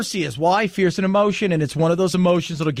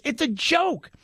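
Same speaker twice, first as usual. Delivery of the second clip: retold scorn, higher pitch; imitated mad; 7 semitones higher, 4 dB quieter, slower than usual. Mexican immigrant dismisses the whole situation, imitated exasperation. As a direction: mimic his frustrated dismissal. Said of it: "he was mad because he had done it right".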